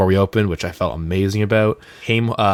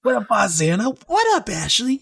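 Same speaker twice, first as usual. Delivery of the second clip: high shout-out greeting; 14 semitones higher than usual. Acted. Shout-out to listeners.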